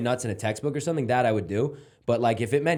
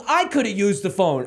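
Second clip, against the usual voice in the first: higher-pitched imitation of an annoyed mom; exasperated mother; 8 semitones higher, 4 dB louder than usual. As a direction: protest like an indignant mother, on the edge of laughing. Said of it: "She goes".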